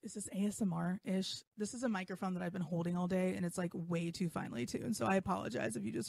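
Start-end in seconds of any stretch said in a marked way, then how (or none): none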